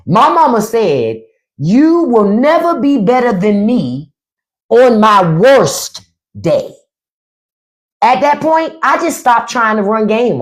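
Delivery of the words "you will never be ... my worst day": slow, every word weighted, "never" and "worst" leaned on; slow emphatic repeat. Shown as a demonstration. Comic repeating mama's line slowly to the crowd. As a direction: spell it out slowly like mama did. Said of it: "I'ma say it again".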